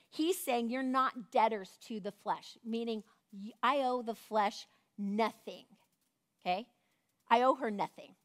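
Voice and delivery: normal talking voice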